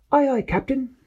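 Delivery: high squeaky sidekick voice; Peso's high voice. Acted. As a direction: chirp it like an eager little helper. Peso answers captain.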